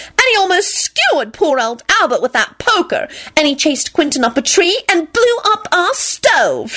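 high, mounting list of accusations; triumphant. A narrator's reading. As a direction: pile up the charges triumphantly. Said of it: "she added triumphantly".

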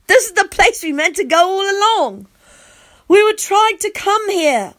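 put-on high, loud excited child hero; blurting out. A narrator's reading. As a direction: blurt it out in a high, eager rush of feeling. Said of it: "I blurt out".

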